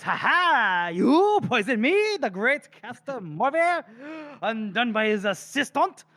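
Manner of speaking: high, theatrical gloat from a cornered poisoner; gloating villain voice. Acted. Poisoner gloating over his assistant, hammy triumph. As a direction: crow with mock triumph, drawing it out. Reading Morvir's gloating lines.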